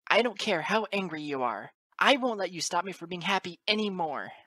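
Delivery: high, loud defiant colt; impassioned outburst. A narrator's reading. Colt standing up to his father, high impassioned outburst. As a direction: declare it loudly in a high young voice. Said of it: "echo of his divine outburst".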